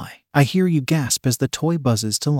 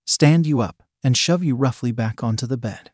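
plain reading voice

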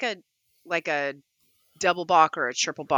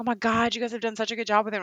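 quick, higher mock gushing; gushing admirers; 5 semitones higher, faster than usual. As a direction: rattle off praise in an imitated gush. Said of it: "people will be like".

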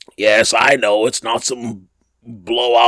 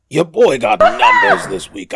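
hyped brag, higher and louder; boastful hype; 12 semitones higher, 5 dB louder than usual. Shown as a demonstration. Host bragging to listeners about his research, higher and louder hype. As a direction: announce the stats like a big-time boast. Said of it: "make it sound like I'm out here".

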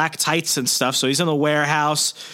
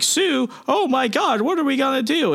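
much higher, hammed-up panic; panicked Aunt May; 12 semitones higher than usual. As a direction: squeal it like a frantic aunt. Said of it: "May is like".

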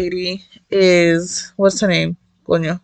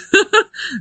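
bright high giggle bursting out; quick real giggle; high laugh; 13 semitones higher than usual. Real, not acted. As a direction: laugh at how good it is. Giggles over gorgeous art.

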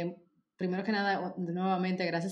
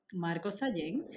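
ordinary talk

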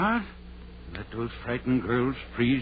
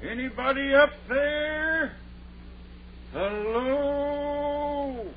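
high, drawn-out call into the night; much slower than usual. Acted. Doctor calling for the girls in the graveyard, high and long. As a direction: call up the hill, long and searching.

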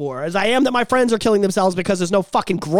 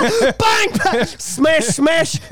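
playful smashing; 8 semitones higher, much slower than usual. Pretend. Mimicking smashing chocolate orange.

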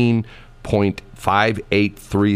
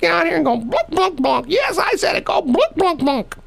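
Granny Shelley. Retold or imitated. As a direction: dare them in a feisty high granny voice. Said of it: "And Shelley would say".

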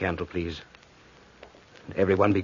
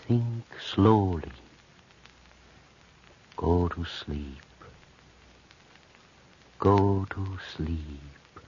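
hypnotic lulling; slower than usual. Acted. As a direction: lull her under like a mesmerist. Hypnotist puts patient in trance.

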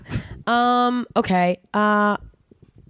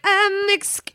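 higher, hesitant French apology; timid French; 9 semitones higher, slower than usual. Retold or imitated. Insecure American in France, hesitant high French. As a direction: squeak out excusez-moi nervously. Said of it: "And I'm like".

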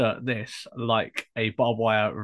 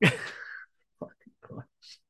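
ordinary talk, nothing marked

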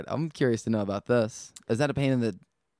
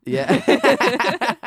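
high, loud laughing yeah; 12 semitones higher, 6 dB louder than usual. Real, not acted. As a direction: burst out laughing. Bursting laugh.

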